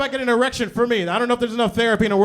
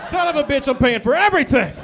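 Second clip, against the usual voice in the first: higher, louder exasperated shout; frustrated outburst; 4 semitones higher, 6 dB louder than usual. Retold or imitated. Comic reliving the crash, exasperated shout. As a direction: blow up at the bad news. Realizing he'll pay.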